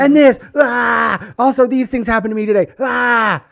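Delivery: a hammed-up furious father yelling at home; imitating raging dad. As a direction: blow up like dad stomping in after work. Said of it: "he comes home and he goes".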